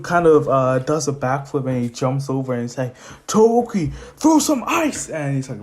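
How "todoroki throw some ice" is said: high, loud shout to an ally; acted urgent call. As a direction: call out a battle order, pitch climbing. Hero calls to ally in fight.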